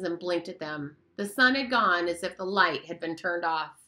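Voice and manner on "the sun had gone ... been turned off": ordinary delivery, nothing marked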